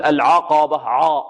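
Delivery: "العقبة" with a thick, back "aw" vowel on ayn; over-heavy ayn. Shown as a demonstration. Teacher shows what to avoid, "العقبة" with heavy ayn. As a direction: darken the ayn of "العقبة" toward 'o. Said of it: "ونحذر كذلك من تفخيم العين".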